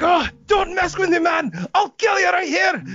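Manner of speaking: high-pitched mock threat; threatening. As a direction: imitate a drunk thug threatening someone. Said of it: "he starts threatening to stab me".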